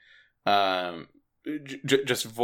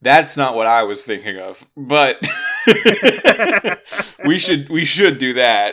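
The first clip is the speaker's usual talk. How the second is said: louder amused director quote; laughing through quote; 3 semitones higher, 6 dB louder than usual. Retold or imitated. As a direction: crack up mid-quote. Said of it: "Christopher Nolan was like".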